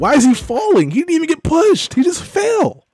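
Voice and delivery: high-pitched disbelief building to a shout; exasperated outburst. Real, not acted. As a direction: rant at the falling linebacker, voice climbing. Linebacker blunder, player exasperated.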